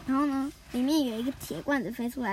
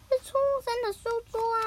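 a hint of a higher, fast child's voice; kid's voice; 9 semitones higher, faster than usual. Nobita pointing out the desk, lightly voiced, higher. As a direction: blurt it out like a kid.

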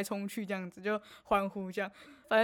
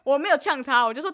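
ordinary delivery

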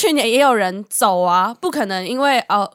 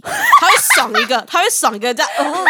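laughing; 9 semitones higher, 6 dB louder than usual. Real, not acted. Joke about groin strike.

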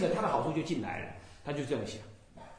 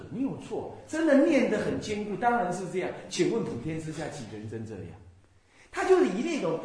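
ordinary talk

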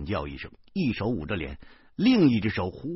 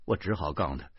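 plain reading voice

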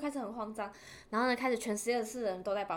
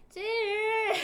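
calling out a name; 9 semitones higher, 4 dB louder than usual. Retold or imitated. Calling friend on phone.

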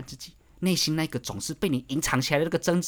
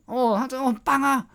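much higher, sing-song mock cheer; mock self-deception; 11 semitones higher, 3 dB louder than usual. Mocking a self-deceiving worker who claims to love his job, high and sing-song. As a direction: parody someone fooling themselves about their job.

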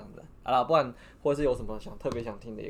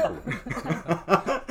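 laughing; 4 semitones higher, 3 dB louder than usual. Real, not acted. Laughter after guest remark.

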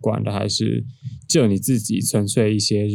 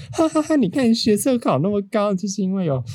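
mocking mom laugh; 10 semitones higher than usual. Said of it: "她就说".

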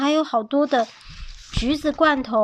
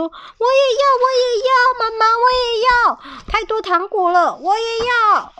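put-on high, whiny little kids pleading; begging kids; 11 semitones higher, 4 dB louder than usual. Kids begging their mothers for candy, high and whiny. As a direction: beg like greedy little children.

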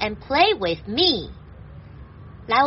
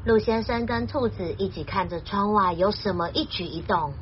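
usual speaking voice

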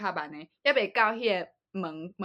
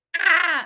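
loud, high crow caw; 9 semitones higher, 10 dB louder than usual. Retold or imitated. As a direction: copy a crow's caw. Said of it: "你也学得蛮好".

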